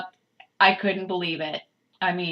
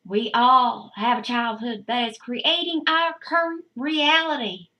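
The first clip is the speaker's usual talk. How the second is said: put-on Southern accent; 3 semitones higher than usual. Reading her book aloud to viewers in a light Southern accent. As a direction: read it like a Southern preacher lady. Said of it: "I'm gonna read this in an accent".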